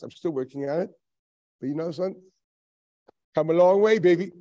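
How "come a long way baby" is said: cheerful boast. Real, not acted. Self-praise, teasing.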